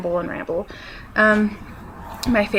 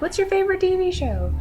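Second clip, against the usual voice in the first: high mock question from a classmate; 11 semitones higher than usual. Classmate mockingly quizzing the teen, in a high put-on voice. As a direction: play the teasing kid asking.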